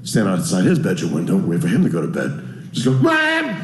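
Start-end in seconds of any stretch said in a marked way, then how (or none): 2.9-3.5 sudden high yelled name; mock yell. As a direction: bellow the name up at his window. Yelling at the worker's window.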